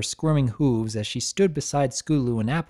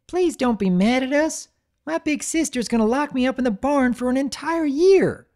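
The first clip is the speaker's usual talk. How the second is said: high pleading wail; high tearful pleading; wailing like an infant; 12 semitones higher than usual. A narrator's reading. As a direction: beg through tears like a small child. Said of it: "wailed like an infant".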